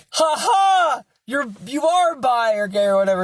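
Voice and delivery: high, crowing "ha ha" and taunt; gleeful accusation. Retold or imitated. Teasing a friend about being bi, gleeful accusation. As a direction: crow it high like catching someone out.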